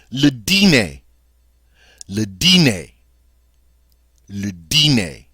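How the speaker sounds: "dîner" as dee-NAY, stress on the end; French pronunciation. Shown as a demonstration. Teacher repeating "Le dîner" for students. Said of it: "that's how we say supper or dinner".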